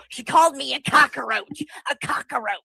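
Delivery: mocking him with "cockroach" as cock-a-roach; imitating his pronunciation. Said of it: "I don't know why he says it like that".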